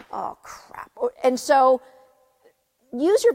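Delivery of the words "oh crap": soft breathy whisper of dismay; whispered oh crap. Retold or imitated. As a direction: catch yourself and whisper it. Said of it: "I lose my temper and then I go".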